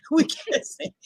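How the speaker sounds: breaking up into laughter; laughing. Real, not acted. Admitting to the friend they can't sing, laughing through it. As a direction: say it through a laugh, voice nearly gone. Laughing after their bad singing.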